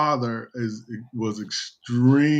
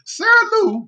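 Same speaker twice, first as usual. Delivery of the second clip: very high squealed welcome; mock falsetto cry of joyful greeting; imitating overjoyed relatives; 3 dB louder than usual. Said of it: "they were like".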